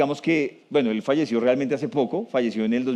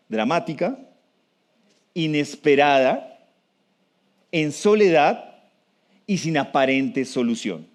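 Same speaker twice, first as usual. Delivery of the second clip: measured teaching pace, each item set apart; slow, deliberate. Shown as a demonstration. Lecturer repeating the key conditions to the audience, slowly. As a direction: list it slowly, a long pause after each. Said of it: "Voy a decirlo despacio".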